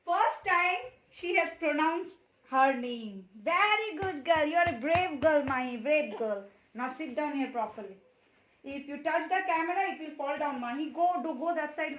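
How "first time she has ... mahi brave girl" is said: loud, slow proud praise. Real, not acted. Mother praising her daughter for saying her name, loud and proud.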